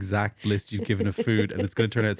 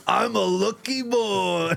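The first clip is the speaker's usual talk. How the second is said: much higher, slow cartoon boy voice; cartoon character; 8 semitones higher, much slower than usual. Host playing a cartoon boy, high and slow. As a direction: stretch it out like a goofy cartoon kid. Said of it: "I'm a cartoon character as well".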